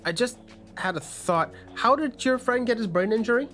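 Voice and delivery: normal talking voice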